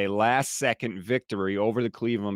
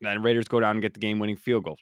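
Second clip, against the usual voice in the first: usual speaking voice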